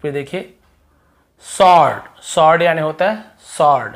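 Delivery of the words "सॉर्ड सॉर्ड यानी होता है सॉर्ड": usual speaking voice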